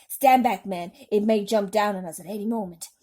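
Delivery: loud warning shout; yelling. A narrator's reading. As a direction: shout the men back in alarm. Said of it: "yelled the chief of police".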